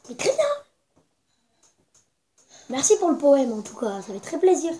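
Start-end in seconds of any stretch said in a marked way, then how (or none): 0.1-0.5 sulky. Acted. Élève vexé.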